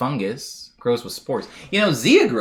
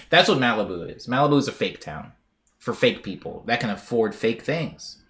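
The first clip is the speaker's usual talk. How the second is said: usual speaking voice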